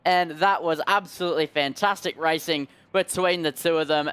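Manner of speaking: rising pitch to the finish, then easing; excited play-by-play. Real, not acted. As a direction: call a close finish live. Calls the finish live.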